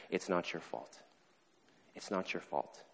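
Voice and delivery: low, hushed, consoling voice; quiet, gentle insistence; tender reassurance. Retold or imitated. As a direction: say it softly, as if holding someone. Counselor holds him and reassures.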